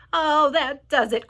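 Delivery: slow, loud wail of doom; loud, dragged-out exclamation; crying out. A narrator's reading. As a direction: cry out in despair, drawn out. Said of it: "cried the earthworm".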